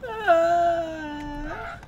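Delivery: loud, high sustained sob; hammed-up drunken wail; wailing cry. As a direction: bawl like a weepy drunk. Drunk character bursts into tears.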